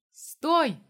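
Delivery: loud, high cry of alarm; urgent shout. A narrator's reading. Hero stops Dima rushing to the door, urgent shout. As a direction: call out sharply to halt someone. Calling out to stop his friend.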